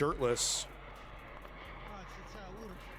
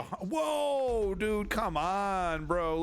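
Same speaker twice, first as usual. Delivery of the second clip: surprised; 5 semitones higher, much slower than usual. Real, not acted. Another blast on footage.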